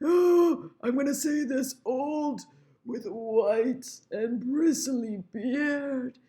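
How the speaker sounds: high, slow, hammed-up old voice; exaggerated aged stage voice; old person's voice. Shown as a demonstration. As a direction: stretch it out like a wobbly elder. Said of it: "do an old voice there".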